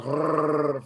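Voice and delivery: long trilled R for no reason; comic rolling R; mock R roll. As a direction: trill out an exaggerated R. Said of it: "I just can't be outside just".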